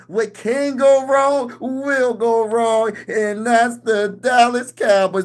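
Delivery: high-pitched, very slow mocking quote; exaggerated slow, high needling delivery; drawn-out taunt. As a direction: stretch each word out high, teasing the fans. Replays his own quoted taunt.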